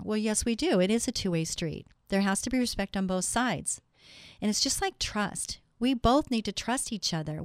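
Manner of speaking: ordinary talk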